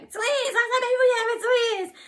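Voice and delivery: high, loud squealed exclamation; re-enacted excitement. Couple reacting together to twins news, high excited shout. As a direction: shout the news in mimicked joy. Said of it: "So he was so excited".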